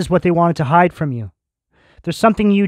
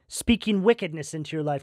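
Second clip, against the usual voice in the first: ordinary talk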